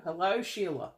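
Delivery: put-on light, playful Australian accent greeting. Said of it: "I talk to Sheila in an Australian accent".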